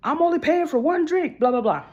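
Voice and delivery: high-pitched mock indignation; upset date. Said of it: "this guy gets so upset".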